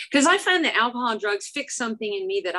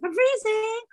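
high, whiny complaint of freezing; mock freezing whine; 13 semitones higher, slower than usual. Speaker answering the locals, high mock shiver. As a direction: squeak it like you're shivering. Her own retort about cold.

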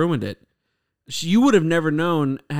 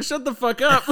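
laughing mock echo; 11 semitones higher than usual. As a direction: echo it higher, laughing through it. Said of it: "I was like".

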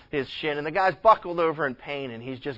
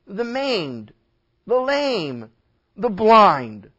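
higher, impassioned sermon delivery; preaching; 7 semitones higher, 7 dB louder than usual. Real, not acted. Preacher telling the church about the law and pride, preaching. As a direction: drive the point home to the congregation.

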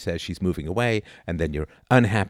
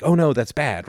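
mock dismay; 8 semitones higher, 5 dB louder than usual. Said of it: "you're like".

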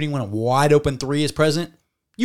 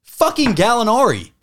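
excited; 6 semitones higher than usual. Real, not acted. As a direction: build up to the name. Hyping up an unsung hero.

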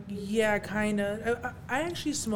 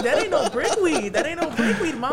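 louder, higher laughing taunt; louder laughing tease of his mom; 7 semitones higher, 8 dB louder than usual. Retold or imitated.

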